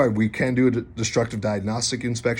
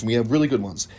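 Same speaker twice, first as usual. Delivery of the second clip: ordinary talk, nothing marked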